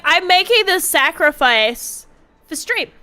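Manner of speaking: ordinary talk, nothing marked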